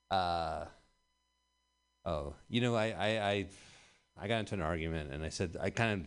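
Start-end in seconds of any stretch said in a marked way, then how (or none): none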